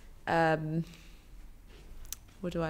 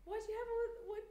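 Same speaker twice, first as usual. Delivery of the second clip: amazed; 13 semitones higher, 14 dB quieter, much faster than usual. Real, not acted. Amazed at all the equipment.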